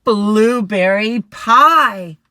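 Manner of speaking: very loud, slow, savouring; delighted bear. Acted. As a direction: drool over the pie. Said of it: "said the bear".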